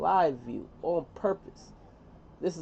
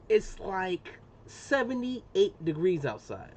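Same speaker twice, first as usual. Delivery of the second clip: usual speaking voice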